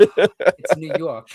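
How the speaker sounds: loud, slow, laughing New York accent. Shown as a demonstration. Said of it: "You gotta say it right".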